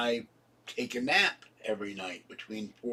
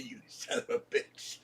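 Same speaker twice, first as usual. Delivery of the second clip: whispered; 8 dB quieter than usual. Retold or imitated. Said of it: "And Patty said".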